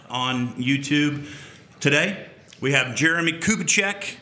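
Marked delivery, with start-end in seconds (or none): none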